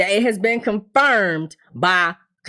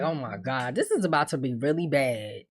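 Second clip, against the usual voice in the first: quiet dread; 7 semitones lower, 7 dB quieter than usual. Retold or imitated. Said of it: "And I'm sitting there like".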